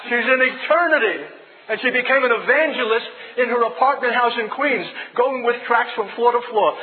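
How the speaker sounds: loud, high fervent preaching. Acted. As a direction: proclaim it to the congregation. Preaching at full force.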